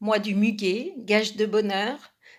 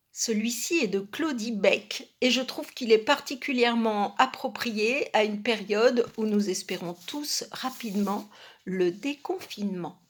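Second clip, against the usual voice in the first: ordinary talk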